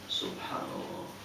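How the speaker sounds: soft whispered subhanallah; whispered awe. Real, not acted. Preacher remembering ihram at Bir Ali, whispered. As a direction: breathe it out in awe.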